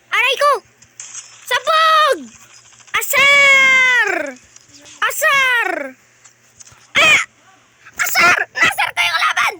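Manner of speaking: loud, high gamer yelling; excited yelling. Real, not acted. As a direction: shout in triumph at the enemy. Yelling while teasing the enemy.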